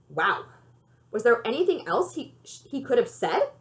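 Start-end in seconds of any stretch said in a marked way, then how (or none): none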